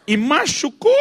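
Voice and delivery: high-pitched, overdone maternal concern; fussing mother. Acted. Mother asking crying son about injury, cooing concern. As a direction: ask in an exaggerated mommy voice. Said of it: "Aí a mãe vira pro menino e diz".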